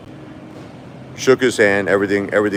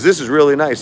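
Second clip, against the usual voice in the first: mock Trump approval; 8 semitones higher, 4 dB louder than usual.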